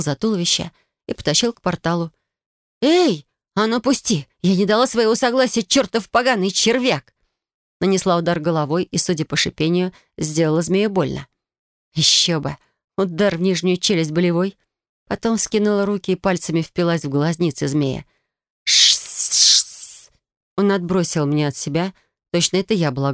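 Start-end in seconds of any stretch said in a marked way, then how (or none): 2.8-7.1 shouting. A narrator's reading. Struggling against the serpent. 18.6-20.1 sharp pained snake hiss. A narrator's reading. Serpent in pain.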